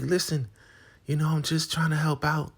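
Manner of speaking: very high, sugary put-on voice; sweet helper. Woman bringing food to Marva, very high and sweet. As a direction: offer kindly. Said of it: "And she's like".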